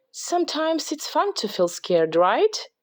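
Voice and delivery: put-on high, excited girl voice pointing things out; little girl voice, curious and playful; bright little girl Lucy. A narrator's reading. Lucy voiced in read story.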